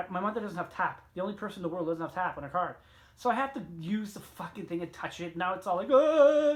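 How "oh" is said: loud mock-disgusted oh; exaggerated oh. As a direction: blurt it out louder, grossed out.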